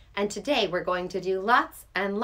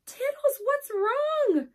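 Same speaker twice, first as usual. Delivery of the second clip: surprised call; 4 dB louder, slower than usual. Acted. Gasps, calls to cat.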